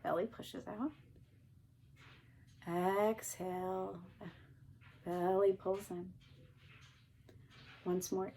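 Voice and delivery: slow, drawn-out breathing prompts; slow breathing cues. Shown as a demonstration. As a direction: pace each cue with the breath.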